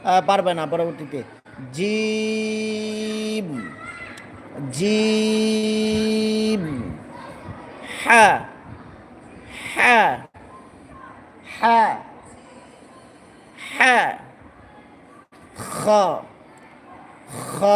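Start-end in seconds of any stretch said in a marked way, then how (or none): 1.7-6.9 slow chanted letter name জিম; long drawn-out jim. Shown as a demonstration. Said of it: "ভালো করে দেখাচ্ছি". 8.0-14.2 হ্যাঁ pushed out loud and firm from the throat; louder clipped হ্যাঁ, falling; forceful throat letter. Shown as a demonstration. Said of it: "শক্ত করে বলতে হবে".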